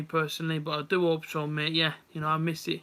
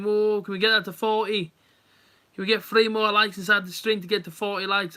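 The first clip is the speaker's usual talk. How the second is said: excited; 4 semitones higher, 6 dB louder than usual. Real, not acted. Begging viewers for likes.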